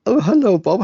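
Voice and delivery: high, loud mimicked hello; playful mock come-on; seductive greeting, imitated. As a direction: imitate her greeting Bob with a big lift in pitch. Acting her greeting to Bob.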